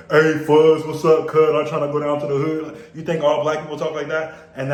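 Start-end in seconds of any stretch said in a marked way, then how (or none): 0.1-2.7 mock street voice.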